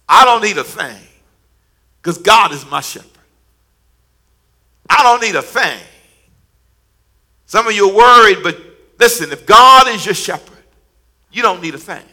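rising, loud preaching cadence; full preaching. Acted. Pastor builds toward the congregation with loud preaching. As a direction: preach it up to the rafters.

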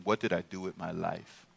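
low, slow, soft pondering; musing to himself in a low voice; brooding inner thought. Acted. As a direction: think aloud with heavy pauses, lingering on each question. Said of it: "he's thinking".

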